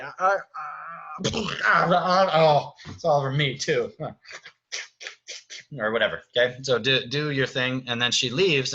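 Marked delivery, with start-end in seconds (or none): 0.0-3.9 high, cracking, stammering Beast; flustered Beast whimper. Shown as a demonstration. Beast spills soup on himself and stammers, close to crying. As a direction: stammer it out near tears. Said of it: "So he's like".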